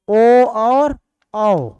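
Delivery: ओ और औ, each vowel stretched out slowly and loudly; drawn-out vowels. Shown as a demonstration. Teacher spelling out vowels for the class, stretched slow. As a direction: hold ओ and औ long, as if dictating.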